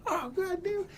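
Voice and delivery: high-pitched imitation of a cracked-up boss; laughing boss impression. Boss privately delighted with fired worker, high mock-laughing voice. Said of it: "rolling laughing".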